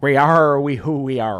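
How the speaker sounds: a light pirate growl. Shown as a demonstration. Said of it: "Let me say that like a pirate".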